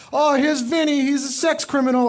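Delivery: high, loud mocking taunt; high playground insult; taunting kid voice. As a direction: jeer it like a schoolyard bully. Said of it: "Or like".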